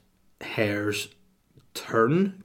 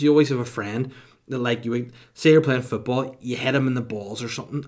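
ordinary talk, nothing marked

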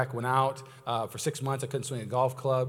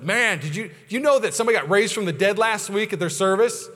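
mock amazed gossip, a bit higher; excited church buzz; 6 semitones higher, 6 dB louder than usual. Churchgoer marvels at another church's miracle, excited buzz. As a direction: pass on astonishing news, slightly punched.

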